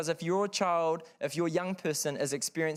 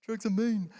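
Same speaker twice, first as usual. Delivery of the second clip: higher-pitched mock teenage protest; whiny teenage self; 8 semitones higher than usual. Teenager protesting to parents about drug rules, mock indignant. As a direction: imitate a wronged teenager, pitched up.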